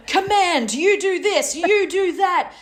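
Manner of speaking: high, loud barked orders; bossy commander. Retold or imitated. Mimicking Jared barking orders in the storm, high and loud. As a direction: snap orders like a stressed commander. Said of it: "his cap on of, like".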